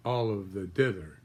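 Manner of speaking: ordinary delivery, nothing marked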